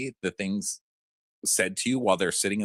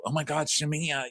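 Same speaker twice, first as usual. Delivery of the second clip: ordinary talk, nothing marked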